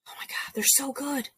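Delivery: whispered, breathless oh my God, they're so good; gushing whisper. Real, not acted. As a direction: whisper it like a secret rave. Gushing over radio hosts.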